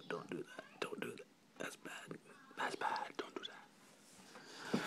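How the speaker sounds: very soft whisper; whispered self-scolding. Pretend. Vlogger chiding himself after an outburst, whispered scolding. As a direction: tell yourself off under your breath.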